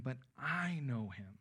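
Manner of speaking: measured emphasis on "I"; stress on "I". Acted. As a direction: lean slowly on "I" and let the rest drop. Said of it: "the I is emphatic here".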